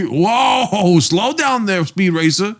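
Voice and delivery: high, drawn-out whoa; high-pitched playful protest; mock reining-in. Real, not acted.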